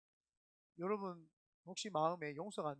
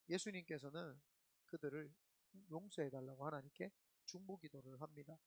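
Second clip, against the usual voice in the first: ordinary talk, nothing marked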